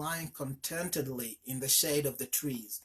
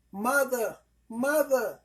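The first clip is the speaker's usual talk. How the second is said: put-on much higher, louder child voices calling; little children crying out in joy; 9 semitones higher, 7 dB louder, slower than usual. A narrator's reading. Children in the stomach calling to their mother, high cries. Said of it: "they cried when they saw her".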